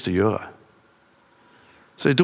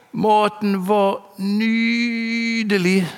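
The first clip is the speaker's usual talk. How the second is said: shouted at full voice; 11 semitones higher, much slower than usual. Retold or imitated. Thin patient yelling his thanks after the departing driver, high slow shout. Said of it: "ropte han, alt han kunne".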